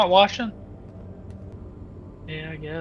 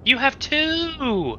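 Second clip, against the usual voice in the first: much higher, very slow whine; panicking gamer; 14 semitones higher, much slower than usual. Real, not acted. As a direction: stretch it out in disbelief. Panicked argument over almond water.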